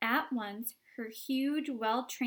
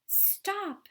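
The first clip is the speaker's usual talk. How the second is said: alarmed stop; 10 semitones higher than usual. Acted. Wonka alarmed.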